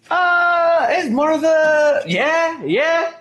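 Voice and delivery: high, loud excited yell; excited shout. Real, not acted. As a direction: shout it out, suddenly thrilled. Excited realization shouting a name.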